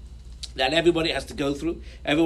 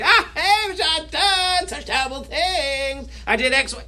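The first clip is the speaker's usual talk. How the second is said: high, slow, wailing cry of regret; mock wailing remorse; 9 semitones higher, slower than usual. Congregant to the rabbi, confessing his sins in a high mock wail. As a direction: moan it out like a sinner beating his chest, much higher.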